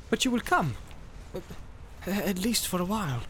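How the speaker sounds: high, soft coaxing; insistent but hushed plea; persistent urging. A narrator's reading. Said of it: "Boromir persisted".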